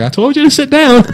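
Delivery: high, sharp scolding driver voice; annoyed bus driver. Retold or imitated. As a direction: snap at the kids like a fed-up driver. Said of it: "and the bus driver's like".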